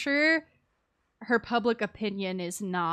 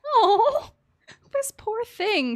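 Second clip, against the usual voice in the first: high, slow sympathetic coo; pitying coo; 11 semitones higher, 5 dB louder, much slower than usual. Real, not acted. Streamer pitying the lonely character, high slow coo. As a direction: melt with pity for her.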